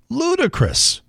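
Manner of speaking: high-pitched mock disbelief; mocking incredulity. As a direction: throw the word back high and mocking. Repeating columnist's word mockingly.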